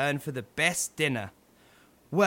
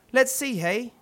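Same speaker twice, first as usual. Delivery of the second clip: ordinary delivery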